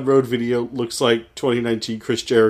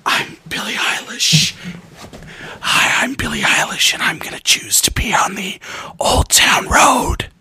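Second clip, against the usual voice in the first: breathy whispered pop star impression; [name] whisper; 4 semitones higher than usual. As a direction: whisper to the mic, ASMR-style. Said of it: "what is this voice?".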